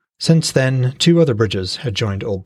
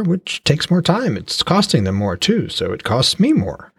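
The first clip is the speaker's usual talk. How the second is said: a hint of a proud fishmonger, slightly higher; lightly voiced trader; 7 semitones higher than usual. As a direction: praise your catch like a cheerful trader. Trader Uni voiced in story.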